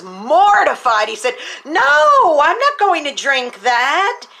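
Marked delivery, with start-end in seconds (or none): 1.6-2.4 high, loud yelp of refusal; retold boy's high startled shout; shocked no. Boy mortified by poison. 2.4-4.3 put-on slow, raised voice of a scared boy; slightly higher and slower in mock fright; imitating a scared teen refusing; alarmed refusal. Boy mortified by poison.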